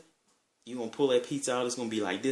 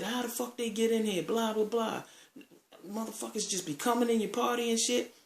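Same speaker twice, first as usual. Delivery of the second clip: imitated rant in a very high voice; mock flipping out; 13 semitones higher than usual. Party host rants about uninvited guests, flipping out. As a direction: keep the indignant complaint pitched way up. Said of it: "flipped out and said".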